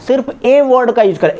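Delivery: ordinary talk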